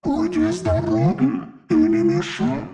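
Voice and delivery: slow, soft, otherworldly demon voice; fire demon. Acted. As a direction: speak like a vast, calm archdemon. Demon lord speaks.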